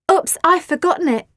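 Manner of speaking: high, loud mock-innocent voice; smiling. A narrator's reading. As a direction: fake forgetting with a smile.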